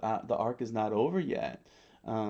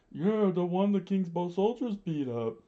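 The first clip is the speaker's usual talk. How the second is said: rebel's voice; 8 semitones higher than usual. A narrator's reading. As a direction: answer high as a second rebel. Another rebel answers in story.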